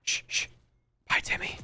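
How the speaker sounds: whispered, teasing farewell; playful whisper. Pretend. Gamer whispering goodbye to a downed enemy, teasing whisper. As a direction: hush them, then whisper goodbye.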